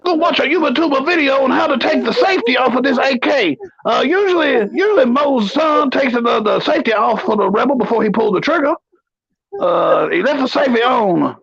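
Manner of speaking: put-on high, blustering old colonel; pompous sputtering military officer. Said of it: "do every top ten in the Colonel voice".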